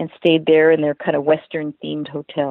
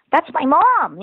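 put-on proud kid; 10 semitones higher, slower than usual. Daughter telling strangers about her mom, high and proud. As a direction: shout it up like a proud child.

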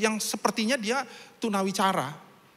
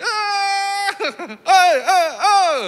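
laughing mock shouts; 13 semitones higher, 9 dB louder, much slower than usual. Preacher imitates him laughing.

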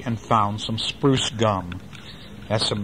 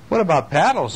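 crisp, matter-of-fact question, pitched higher; businesslike; 6 semitones higher, 4 dB louder than usual. Acted. Small boatman asking the storekeeper for paddles, businesslike. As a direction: sound like a customer who means business. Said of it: "making his voice sound businesslike".

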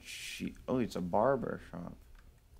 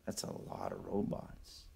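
whispering; 9 dB quieter than usual. Real, not acted. Sneaking past robots.

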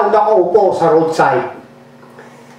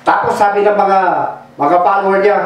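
normal talking voice

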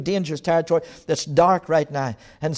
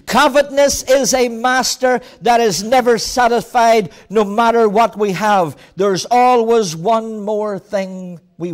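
loud, slow, rising pulpit declaration; fiery sermon voice; full-force preaching; 6 semitones higher, 7 dB louder, slower than usual. Acted. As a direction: preach it out to the whole hall.

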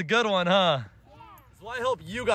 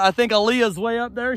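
ordinary talk, nothing marked

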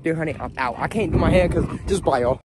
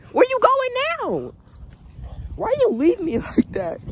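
high-pitched panic. Real, not acted. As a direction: voice jumps way up, alarmed. Dog runs off, panicked.

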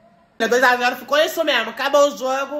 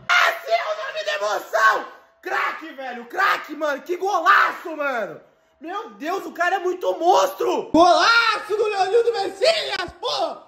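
high shouted goal call; explosive goal commentary; 6 semitones higher than usual. Acted. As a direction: scream the goal call with joy. Goal scored in game.

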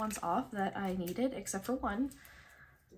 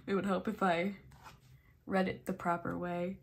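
ordinary delivery

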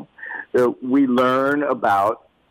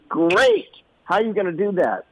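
a much higher, quick Great!; enthusiastic dad; 7 semitones higher than usual. Retold or imitated. Father urging his son onward, a high quick Great. Dad's upbeat challenge again.

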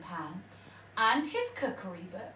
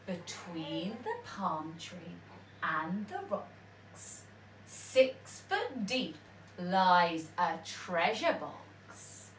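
rhyme reading; 3 semitones lower, slower than usual. A narrator's reading. Reads the map rhyme.

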